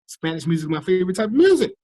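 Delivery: high, loud party hype; excited boasting. Real, not acted. Excitedly boasting about party dancing.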